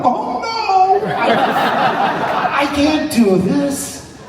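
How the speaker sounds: high, panicky squeal of dread; hammed-up terror at following legends; mock panic. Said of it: "I went".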